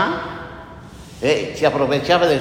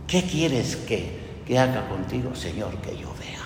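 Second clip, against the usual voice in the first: hushed retold prayer; 7 semitones lower, 7 dB quieter than usual. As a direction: voice a humble request very quietly, ending almost under the breath. Retold prayer of the blind man.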